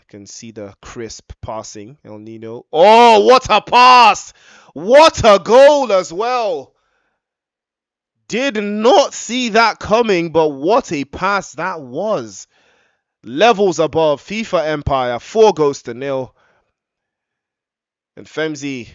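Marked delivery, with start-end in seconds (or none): 2.7-12.5 huge, high, slow goal scream; long goal yell. Real, not acted. Fourth goal called live. 13.2-16.3 loud, high score announcement; excited score call. Real, not acted. Score announced after goal.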